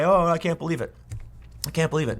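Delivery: half-hearted imitation of tearful disbelief; mock breakdown. Host refusing to cry on air, mock breakdown. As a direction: lightly pretend to break down crying. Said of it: "break down and say".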